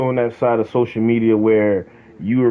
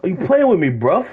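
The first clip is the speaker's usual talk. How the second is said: high mock Brit; 7 semitones higher than usual. Mocking British voice.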